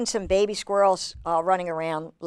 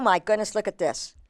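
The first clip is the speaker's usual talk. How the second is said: ordinary delivery, nothing marked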